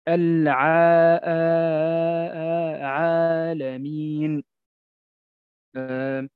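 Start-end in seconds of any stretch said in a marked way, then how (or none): 0.1-4.4 slow عالمين with a long, light, thin آ; thin alif after ain. Shown as a demonstration. Teacher showing students when the alif is thin, slow and drawn out. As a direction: draw the alif of عالمين out thin, not heavy. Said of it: "متى ترقق مثلًا؟".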